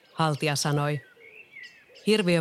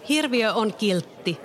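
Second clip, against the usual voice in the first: higher, slow cry of defense; Nelli shouting; 6 semitones higher, slower than usual. A narrator's reading. Nelli shouts at the angry villagers, higher slow cry. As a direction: call it out slowly to the crowd. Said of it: "Nelli huusi".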